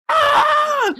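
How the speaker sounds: high, shrill cry of pure hype; ecstatic shriek. Real, not acted. Fan losing it over the moon push, shrieking. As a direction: let out a squeal of excitement.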